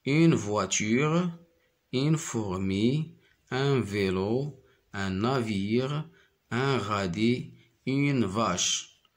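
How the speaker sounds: slow, careful word-by-word reading; slow clear reading. Shown as a demonstration. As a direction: read the list slowly for children. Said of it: "teacher reads word list slowly".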